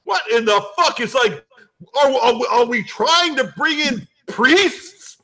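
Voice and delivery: put-on high, incredulous New York accent; New York guy. New York fan appalled by the pick, incredulous New York accent.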